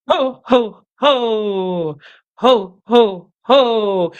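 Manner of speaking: loud chest-voiced belly ho ho; Santa ho ho ho. Shown as a demonstration. Teacher demonstrates chest-resonant Santa laugh. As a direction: drop into the chest and pump each ho. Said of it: "Getting low into our voice".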